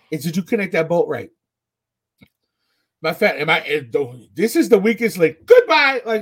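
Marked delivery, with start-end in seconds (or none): 4.3-6.0 mock game show. Weakest link catchphrase.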